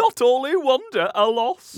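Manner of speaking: put-on old woman voice.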